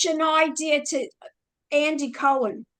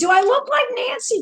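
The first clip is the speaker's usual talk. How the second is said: excited; 9 semitones higher, 4 dB louder than usual. Real, not acted. Host asks excitedly.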